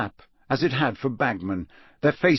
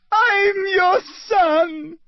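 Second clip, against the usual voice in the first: crying scream; 5 dB louder, much slower than usual. Acted. Boy repeats, sobbing.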